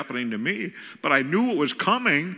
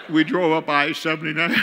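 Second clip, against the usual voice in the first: ordinary talk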